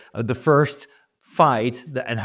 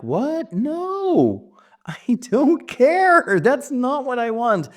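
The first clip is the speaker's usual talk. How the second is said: high, strained protest with a whispered laugh; frustrated girl refusing the reward; 13 semitones higher, 3 dB louder, much slower than usual. Acted. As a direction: push back, exasperated and high. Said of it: "super frustrated and angry".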